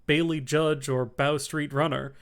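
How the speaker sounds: plain reading voice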